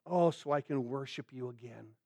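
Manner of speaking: low, very soft prayer; hushed longing. Acted. Psalmist longs to worship God again, hushed longing. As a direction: drop right down into a tender, private sigh of worship.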